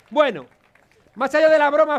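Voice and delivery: ordinary delivery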